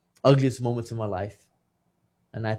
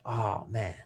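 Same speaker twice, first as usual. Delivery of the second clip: quiet, sinking sigh of regret; deflated regret; 9 dB quieter than usual. Retold or imitated. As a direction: say it softly, as guilt lands. Retold regret on finding wife's note.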